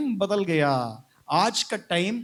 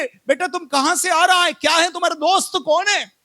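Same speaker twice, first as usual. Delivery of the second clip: put-on high, raised-voice questioning of a child; acted parental grilling, high-pitched; parent interrogating; 11 semitones higher, 5 dB louder than usual. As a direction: fire off questions like a suspicious parent.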